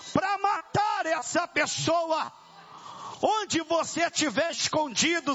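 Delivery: high, shouted challenge to a demon; thundering deliverance preacher; full-force exorcism preaching. Real, not acted. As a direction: confront the evil spirit, pitch climbing high. Confronts the demon before congregation.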